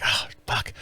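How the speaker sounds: whispered under the breath; muttered curse. Retold or imitated. Running late in the store, a whispered curse. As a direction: hiss the curse quietly. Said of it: "I'm like".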